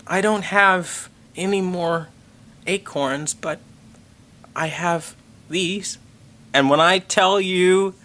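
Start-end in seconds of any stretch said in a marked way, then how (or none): 0.0-6.0 slow, sweet, higher imitation of his wife; lightly voiced sweet wife offering a gift; cute wife voice. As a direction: coo it like a shy adorable girl. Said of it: "And she goes".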